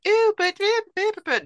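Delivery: high whining imitation of a feeble objection; high, mocking whimper of a protest; mock whiny protest. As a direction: squeak out the excuse like a wimp.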